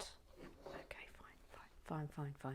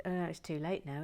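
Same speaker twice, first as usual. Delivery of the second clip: ordinary talk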